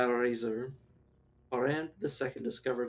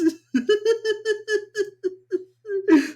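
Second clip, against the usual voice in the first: high, loud crying laughter; laughing till crying; helpless shrieking laugh; 9 dB louder than usual. Real, not acted. Helpless laughter at chat joke.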